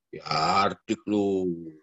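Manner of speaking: a slow, low mock rebuke; slow mock scolding. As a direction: drag it out slowly, low. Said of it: "slow mock scolding". (hardikan lambat dan rendah)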